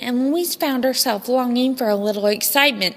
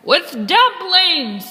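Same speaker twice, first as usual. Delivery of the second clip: pirate suggestion; 7 semitones higher, 5 dB louder, much slower than usual. Acted. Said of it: "said another".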